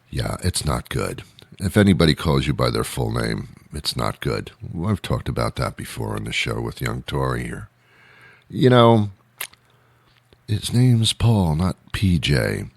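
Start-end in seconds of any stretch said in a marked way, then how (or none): none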